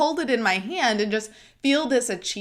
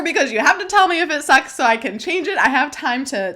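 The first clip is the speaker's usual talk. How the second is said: anxious plea; 6 semitones higher, 6 dB louder, faster than usual. Retold or imitated. Anxious plea to friend quoted.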